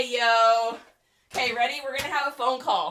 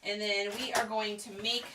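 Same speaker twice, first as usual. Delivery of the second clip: usual speaking voice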